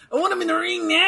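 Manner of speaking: high wrestler promo voice; Rick Steiner impression. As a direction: imitate a wrestler's promo yell. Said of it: "love how Rick Steiner talk".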